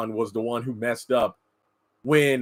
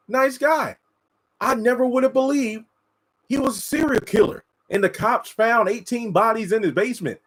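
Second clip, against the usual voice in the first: higher, mock stunned TV-interview neighbor; shocked neighbor; 10 semitones higher than usual. Neighbor on a Lifetime show describing the killer next door, mock stunned and higher. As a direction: play the neighbor who never suspected a thing. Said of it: "let's say".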